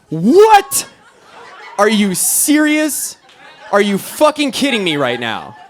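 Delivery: high, loud shriek easing into exasperation; outraged disbelief. Acted. Rescuer hearing the couple worked everything out, outraged disbelief. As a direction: explode in incredulity, then drag the words out.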